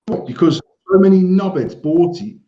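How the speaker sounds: ordinary delivery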